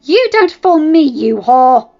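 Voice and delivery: furious shout of the insult; jealous wife screaming at her rival; angry accusation. Acted. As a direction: spit it at her in fury.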